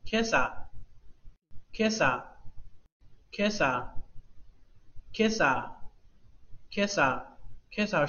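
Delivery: slow repeated Kesa, leaning on Ke; stress on first syllable. Shown as a demonstration. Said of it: "重读第一个就变成了". (Kesa：重读Ke，sa降下来，慢慢重复)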